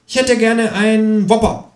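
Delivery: high-pitched, drawn-out kangaroo voice; high, unhurried character voice; kangaroo's order. A narrator's reading. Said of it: "sagt das Känguru".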